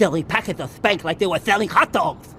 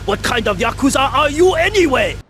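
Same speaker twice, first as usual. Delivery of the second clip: louder, higher, scolding; angry outburst; 8 semitones higher, 6 dB louder than usual. Acted. Boss scolds useless yakuza, angry and raised. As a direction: snap at them for being useless.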